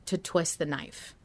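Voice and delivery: normal talking voice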